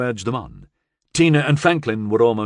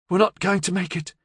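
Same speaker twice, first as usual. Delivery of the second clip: panicked; 9 semitones higher than usual. A narrator's reading. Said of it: "She was panting heavily now".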